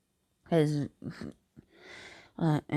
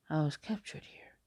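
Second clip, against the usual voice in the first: whispered; 8 dB quieter than usual. Acted. Kid lying to pirates.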